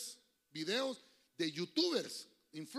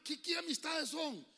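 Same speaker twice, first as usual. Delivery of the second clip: high, slow, shouted question; preacher's shout; 8 semitones higher than usual. Real, not acted. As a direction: cry it out to the congregation. (pregunta gritada con fuerza)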